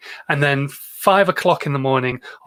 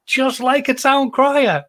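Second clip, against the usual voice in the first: high, slow herald's call; town crier; 10 semitones higher, 3 dB louder, slower than usual. Pretend.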